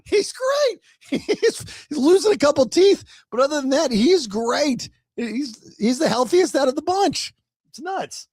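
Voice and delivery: bubbling over about the dog; excited high voice. Real, not acted. Amused about old dog.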